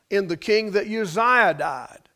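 ordinary delivery

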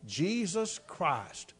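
slow, soft, low murmur; preacher echoing the boy's words in a hush; hushed repeat. Retold or imitated. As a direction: let the words fall slowly and quietly. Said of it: "That man said".

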